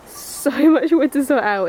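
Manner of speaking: laughing through the words; amused disbelief at the huge pile of wood. Real, not acted.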